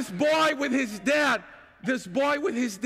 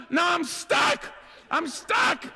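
panicked; 6 semitones higher, slower than usual. Retold or imitated. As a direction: wail that you're stuck. Stuck on plank, panicking.

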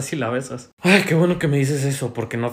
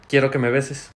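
normal talking voice

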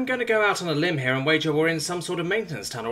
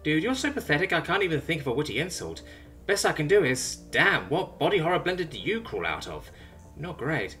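higher, breezy teasing insult; playful berating; 6 semitones higher than usual. Acted. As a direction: needle him, almost playfully. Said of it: "Simone berates, almost playfully".

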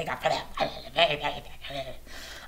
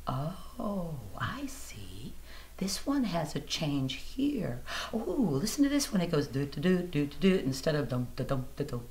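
softer, hushed discovery; dawning understanding; quiet realization; 5 dB quieter than usual. Retold or imitated. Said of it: "and I said".

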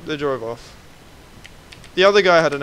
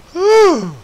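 relieved whew; 12 dB louder than usual. Real, not acted. Relief after firefight.

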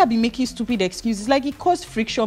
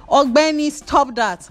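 sharp scolding, higher and louder; mind scolding; 7 semitones higher, 6 dB louder, slower than usual. Pretend. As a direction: scold yourself like a stern friend.

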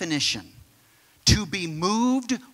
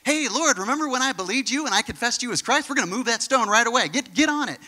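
high, rapid-fire imaginary Martha; fast, hammed-up enthusiasm; mock eagerness; 5 semitones higher, faster than usual. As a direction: rush it out as an over-keen believer.

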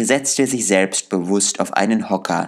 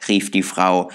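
ordinary delivery, nothing marked

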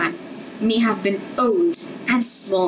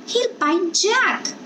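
put-on high, slow child's voice, worried; a little girl fearing for her dog; small girl fretting; 10 semitones higher, slower than usual. A narrator's reading. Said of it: "the girl said".

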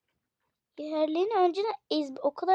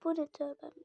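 whispered surprise; 8 dB quieter than usual. Real, not acted. Surprised by new pet.

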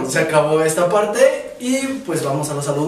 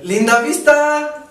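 bus caller; 6 semitones higher, 4 dB louder than usual. Retold or imitated. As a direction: call out stops like a bus driver. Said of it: "cuando anuncian los de que se suben a, al camión".

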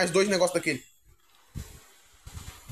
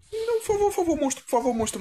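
pleading; 10 semitones higher than usual. Real, not acted. Begging monster while fleeing.

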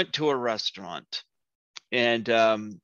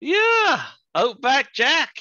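excited; 14 semitones higher, 7 dB louder, much slower than usual. Real, not acted. Proudly showing off a figure.

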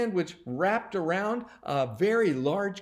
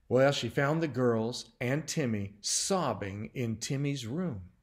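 ordinary delivery

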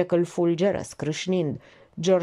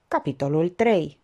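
ordinary delivery

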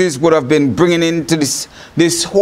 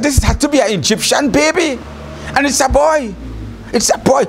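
higher, a lightly voiced princess; Pharaoh's daughter; 6 semitones higher, faster than usual. Pharaoh's daughter finding the baby in the basket, higher and lightly voiced. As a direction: pitch it up as the surprised princess. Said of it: "She said".

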